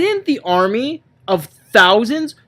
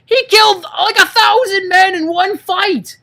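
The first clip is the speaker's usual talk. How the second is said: exasperated rant; 10 semitones higher than usual. Real, not acted. Ranting to his friend about Samson's pointless mercy, high and exasperated. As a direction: stretch it out in disbelief.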